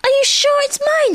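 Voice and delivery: very high, squeaky little voice; excited squeak. A narrator's reading. Piglet asks if he is winning, squeaking excitedly. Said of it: "squeaked Piglet excitedly".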